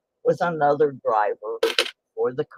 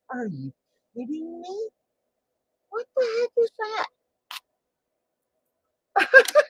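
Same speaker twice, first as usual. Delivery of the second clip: rising into high squeal of disbelief; are you kidding, climbing higher. Real, not acted. To her viewers, a high incredulous squeal. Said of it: "disbelief at report".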